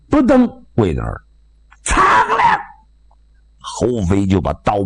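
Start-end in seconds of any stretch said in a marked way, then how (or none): 1.8-2.6 ringing sword draw. Acted. Hou Fei draws his sword on the cook, sharp clang. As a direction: ring out the sword sound. Blade drawn from sheath.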